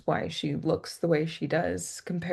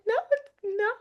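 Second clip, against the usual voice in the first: tearful protest. Retold or imitated. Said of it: "I'm like".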